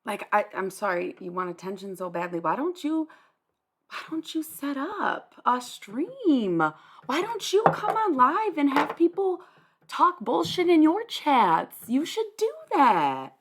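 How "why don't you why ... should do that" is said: mocking taunt. Real, not acted. Taunting chatter to stream.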